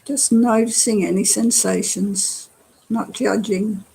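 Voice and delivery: unhurried meditation guide with long pauses; guided meditation. Acted. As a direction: guide them gently through relaxing, phrase by phrase. Leads a body-scan meditation.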